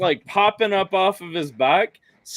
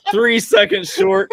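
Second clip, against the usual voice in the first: high, slow, laughing words; 5 dB louder than usual. Real, not acted. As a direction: squeak it out through laughter. Laughing while pitching the clip.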